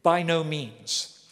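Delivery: slow, stressed By no means; emphatic. Retold or imitated. Paul answering the objection, slow emphatic denial. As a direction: lean hard on By no means, slowly. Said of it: "answers it with an emphatic".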